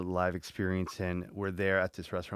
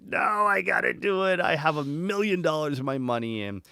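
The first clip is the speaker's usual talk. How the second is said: higher, insistent self-argument, fading down; arguing yourself into it, pitched up; stubborn inner voice; 7 semitones higher, 3 dB louder than usual. Retold or imitated. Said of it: "But I'm like".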